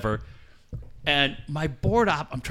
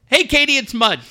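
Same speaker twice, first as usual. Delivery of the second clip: loud, high, cheeky reveal; spring the reveal loud and high; gleeful prank caller; 10 semitones higher, 7 dB louder than usual. Retold or imitated. Prankster revealing himself to the heated host, loud and gleeful. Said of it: "And then Mud goes".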